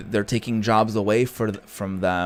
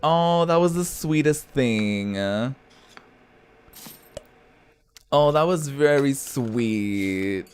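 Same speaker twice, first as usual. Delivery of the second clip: moved and tender; 4 semitones higher, slower than usual. Real, not acted. Streamer touched by the game's baby scene, slow tender awe. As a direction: let it melt you. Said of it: "I was getting emotional".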